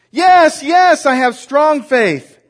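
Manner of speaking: high, loud, slow frantic shout; desperate shout. Acted. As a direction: yell it up, clinging on. Desperate man answers God.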